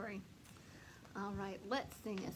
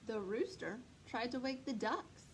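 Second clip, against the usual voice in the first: plain reading voice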